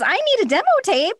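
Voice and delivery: high and eager. Retold or imitated. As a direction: pitch it up with eager urgency. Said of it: "I was like".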